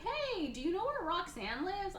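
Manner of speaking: very high, put-on priest voice; clueless priest. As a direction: innocently ask the way in a high voice. Priest role asking the way.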